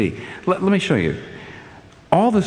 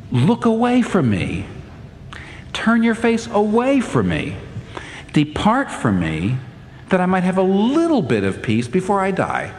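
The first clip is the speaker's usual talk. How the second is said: overwhelmed plea; 9 semitones higher than usual. Acted. David begging God to look away, overwhelmed plea. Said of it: "ends with such overwhelming feelings".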